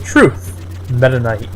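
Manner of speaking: ordinary talk, nothing marked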